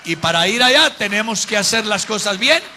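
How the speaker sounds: loud, emphatic sermon climax; forceful preaching. Real, not acted. Preacher urging the church to live rightly for heaven, forceful preaching. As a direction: build to a ringing, full-force close.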